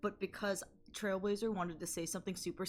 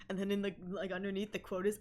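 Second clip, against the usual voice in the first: usual speaking voice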